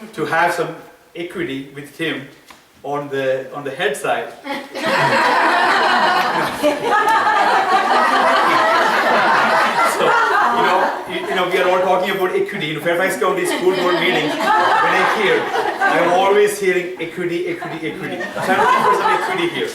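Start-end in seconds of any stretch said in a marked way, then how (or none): 4.4-9.9 loud, high burst of laughter; loud high laughter at own joke; big laugh. Real, not acted. Laughs at own equity pun. 10.0-19.7 laughing through the equity joke, louder; laughing while talking. Real, not acted. Joking about equity while laughing.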